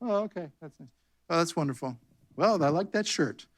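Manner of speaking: airy, sugary nothing-heard reply; mock sweet dismissal. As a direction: brush off the hate with fake politeness. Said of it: "We're just gonna be like".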